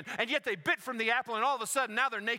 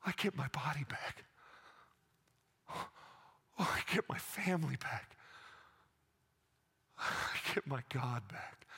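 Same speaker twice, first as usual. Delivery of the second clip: awed realization; 7 semitones lower, 7 dB quieter than usual. Acted. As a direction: breathe it like a quiet revelation. Healed woman realizes her restoration.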